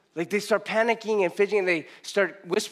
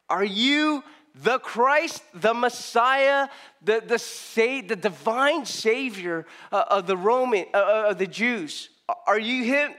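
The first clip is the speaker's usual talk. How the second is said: eager questioners; 5 semitones higher, slower than usual. Retold or imitated. Priests questioning John, acted out.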